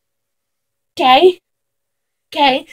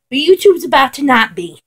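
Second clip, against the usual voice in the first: usual speaking voice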